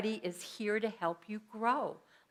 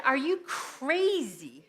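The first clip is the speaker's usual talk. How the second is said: higher, drawn-out incredulous question; retold disbelief; 4 semitones higher, much slower than usual. Reacting to business partner's pregnancy news, incredulous and drawn out. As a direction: stretch out the mock outrage.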